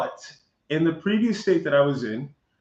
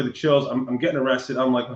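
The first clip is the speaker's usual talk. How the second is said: ordinary talk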